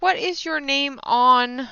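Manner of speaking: ordinary delivery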